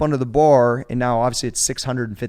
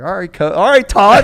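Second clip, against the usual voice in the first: hyped reply; 8 semitones higher, 10 dB louder than usual. Retold or imitated. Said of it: "And I was like".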